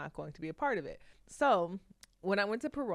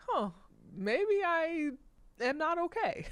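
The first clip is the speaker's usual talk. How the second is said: high, dawning surprise; as if it just hit her; stunned realization; 10 semitones higher, 3 dB louder, slower than usual. Retold or imitated. To herself, realizing she's not okay, dawning surprise. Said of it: "And I was like".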